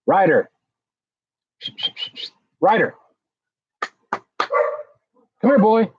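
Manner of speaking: high, carrying call to a dog across the room. Real, not acted. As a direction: call him over, voice lifted high. Calling his dog to come.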